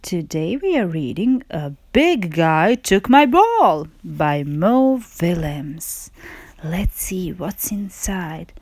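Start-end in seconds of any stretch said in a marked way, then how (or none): none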